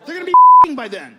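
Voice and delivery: loud fast high punchline; outraged shout. Real, not acted. Comedian shouting the punchline at the crowd. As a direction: blurt it out in disbelief.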